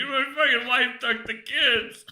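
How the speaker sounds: high, slow mock sob through a laugh; hammed-up crying husband; fake crying. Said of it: "it's like".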